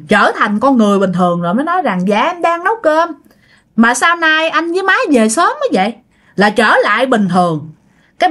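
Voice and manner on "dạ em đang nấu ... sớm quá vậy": higher, innocent wifely reply; lightly voiced daughter-in-law. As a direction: answer your husband as if nothing happened. Wife answers as if normal. (giọng con dâu cao, tỉnh bơ)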